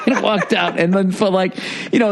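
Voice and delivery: ordinary talk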